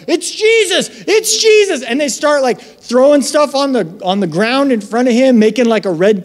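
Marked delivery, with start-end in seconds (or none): 0.1-1.8 high, thrilled cry of recognition; excited crowd. Retold or imitated. Crowd member spotting Jesus, excited high call.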